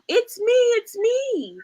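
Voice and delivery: high, slow, sing-song reveal; squeaky little animal cheering it's me. Acted. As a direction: pop out from behind the mask. Cow revealed under mask.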